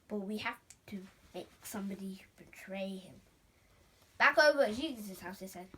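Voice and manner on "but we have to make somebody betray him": secret conspiratorial whisper; plotting in a hush. Acted. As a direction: whisper the plot.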